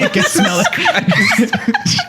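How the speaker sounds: laughing. Real, not acted. Punchline dissolving into laughter.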